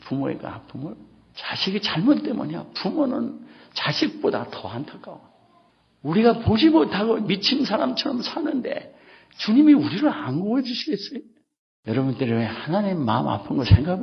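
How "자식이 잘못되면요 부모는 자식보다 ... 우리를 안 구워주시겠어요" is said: rising preaching. Acted. Preaching rises again.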